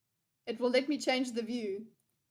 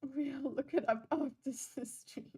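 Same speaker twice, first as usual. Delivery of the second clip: laughing through it; 7 dB quieter than usual. Retold or imitated. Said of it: "He sounds like a dad".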